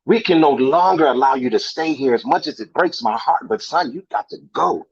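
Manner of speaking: stern parent confronting his son, pitch swinging with disbelief; angry, heartbroken father. Acted. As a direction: act out a father's hurt outrage, punching key words. Acted audition monologue, father confronts son.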